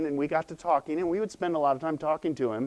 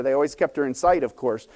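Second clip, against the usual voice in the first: ordinary talk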